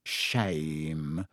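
deadpan fake regret; dry Ron. A narrator's reading. Ron mocking Lockhart's departure.

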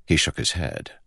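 usual speaking voice

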